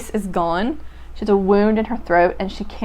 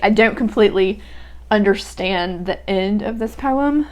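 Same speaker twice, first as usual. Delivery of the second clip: ordinary talk, nothing marked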